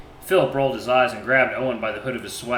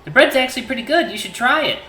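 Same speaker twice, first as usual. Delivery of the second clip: higher, louder teasing sister; 12 semitones higher, 6 dB louder than usual. Acted. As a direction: tease him with a grin. Voices Ginny texting.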